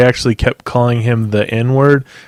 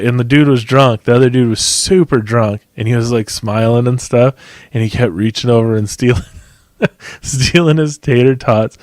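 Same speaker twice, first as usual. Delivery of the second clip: ordinary talk, nothing marked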